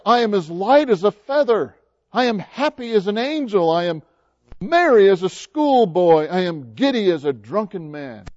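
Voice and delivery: high, exuberant reading; giddy Scrooge. A narrator's reading. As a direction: exclaim it, bubbling over with joy. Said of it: "laughing and crying at the same time".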